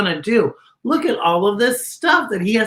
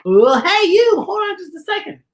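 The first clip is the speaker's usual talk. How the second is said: friendly monsters calling; 8 semitones higher, 4 dB louder than usual. Acted. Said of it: "They said".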